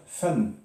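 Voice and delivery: fan said as "fenn", ending on a sounded n; tanwin fatha. Shown as a demonstration. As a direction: say fan as "fenn", not a French nasal vowel. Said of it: "on a l'impression de, d'entendre". (prononcer fan « fenn », n final sonore)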